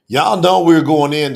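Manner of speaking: loud hype call to the crowd; loud, rallying livestream opener; fired-up opener. Real, not acted. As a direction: pump up the audience. Livestream host hyping audience.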